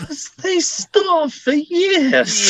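very high, slow, hammed-up sigh of pity; mock theatrical. Cohost camping up the starving actor joke. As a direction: wail it like a tragic diva.

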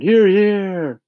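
high, loud cheer of agreement; crowd shouting. A narrator's reading. All the creatures agreeing with the ladybug, shouted high. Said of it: "they all shouted".

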